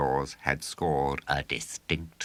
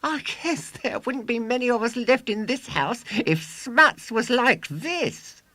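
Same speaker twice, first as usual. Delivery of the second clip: put-on high, loud boastful showman boy; 7 dB louder than usual. A narrator's reading. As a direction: crow it like a swaggering boy showman. William boasting to audience.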